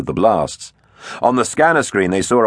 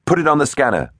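higher-pitched captain's order; 8 semitones higher, faster than usual. A narrator's reading. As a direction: give a command to the crew, pitched up. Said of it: "ordered Tegs".

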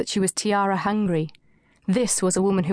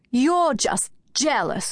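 slow, higher catty comeback; rallying retort; 5 semitones higher, slower than usual. A narrator's reading. Anushka rallying against Kate, slow catty jab. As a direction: bounce back with a slow jab. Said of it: "Anushka rallied".

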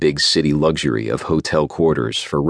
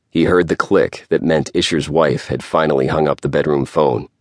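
plain reading voice

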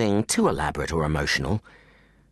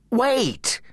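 urgent call. Acted. Jake calls out to stop Jennifer.

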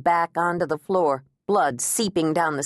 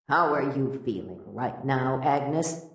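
a lightly lowered, soft doctor's voice; gentle therapist asking, a bit softer; Doctor Garvin gently probing, softer and lower; calm therapist; 5 semitones lower, 4 dB quieter than usual. A narrator's reading.

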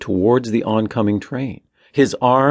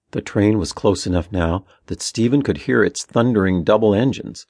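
ordinary delivery

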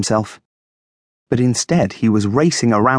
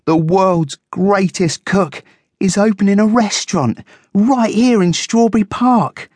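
high-pitched, thrilled guinea pig; excited, high character voice; gleeful; 11 semitones higher, 3 dB louder than usual. A narrator's reading. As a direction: announce big news with squeaky glee. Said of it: "rubbed his paws together in glee".

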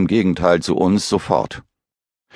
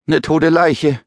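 high shocked sailor voice; shocked blurt; 10 semitones higher, slower than usual. Acted. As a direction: blurt it out, stunned. (fassungslos hervorgestoßen)

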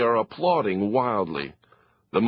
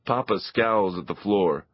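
ordinary delivery

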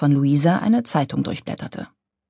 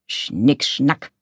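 low, softer grumble; grumbling; 5 semitones lower, 3 dB quieter than usual. A narrator's reading. Karl Wenninger brushes off Luisa's excuse, grumbling. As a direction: mutter it dismissively under the breath. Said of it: "grummelte Karl Wenninger".

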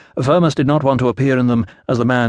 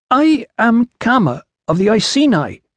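put-on announcing in Iceni accent; 11 semitones higher, 3 dB louder than usual. A narrator's reading. Iceni woman launches into her speech to the official, accented announcement. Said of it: "she announced".

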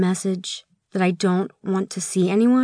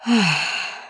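deep sigh. A narrator's reading. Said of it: "I take a deep breath".

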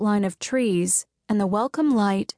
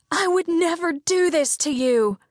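much higher acted scream; 8 semitones higher than usual. Izzy screaming at her sisters as she is taken, high and raw. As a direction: hurl it at them, high and raw. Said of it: "Izzy screamed".

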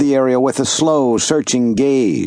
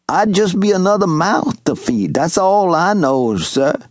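higher, lightly voiced old Southern servant; old servant calling out; 5 semitones higher than usual. Old servant Wash hailing young Master Jubal, lightly voiced. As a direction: call across the clearing in a gentle old man's voice.

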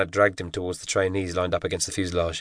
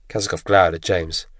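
ordinary delivery, nothing marked

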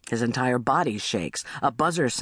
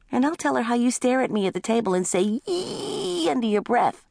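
tattling little brother; 10 semitones higher than usual. Acted. Little brother threatening to tell, high tattling voice. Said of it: "Little brother".

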